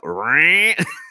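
rising high-pitched whirr; zipping droid noise. Retold or imitated. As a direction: imitate a tiny sped-up droid zooming off. Imitates droid zipping when sped up.

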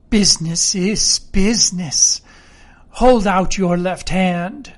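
lightly voiced menacing blind beggar; measured, cold command, slowing at the end; cruel, cold voice. A narrator's reading. Blind beggar orders the sick captain to stay put, coldly. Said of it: "a voice so cruel and cold and ugly".

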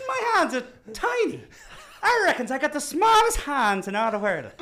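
high, loud, hammed-up drunken boast; drunk dwarf, really into it. Drunk dwarf boasting of tiny hands, hammed-up high shout. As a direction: brag like a tiny rowdy drunk. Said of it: "he's really into it".

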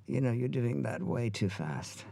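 slow, soft mock Australian accent; bad Australian accent, gently drawn out; Sam Neill. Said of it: "This is a horrible Australian accent".

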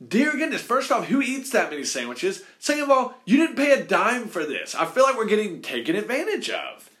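incredulous mock rant from the staff; high exasperated rant. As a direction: play the fed-up staff, pitch way up. Employees' inner outrage voiced.

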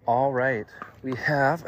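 breathless, panting between words; out of breath. Real, not acted. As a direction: catch your breath while talking. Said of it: "sorry, I'm out of breath here".

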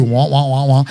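droning meaningless wah wah like an adult in a cartoon; Charlie Brown's teacher. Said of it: "And Charlie Brown's teacher too".

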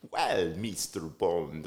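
slow, low, drawn-out villain purr; Bond villain voice. Retold or imitated. Retelling the sketch, the villain in the back seat greeting Bond. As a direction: draw out the name like a smug villain. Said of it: "the guy goes".

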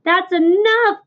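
a rat crying out to stop the sheep. Acted. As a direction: cut him off, greedy and overwhelmed. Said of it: "cried Templeton".

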